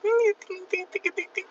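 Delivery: high squeaky fast babble imitating a Spanish speaker; mock rapid-fire Spanish; mock Spanish gibberish. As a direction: rattle off nonsense syllables way up high. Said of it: "But they be like".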